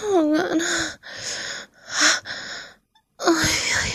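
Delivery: loud, high cries of passion; passionate moaning. Acted. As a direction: cry out in pleasure. Passionate cries during climax.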